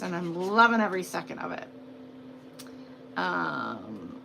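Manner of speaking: ordinary talk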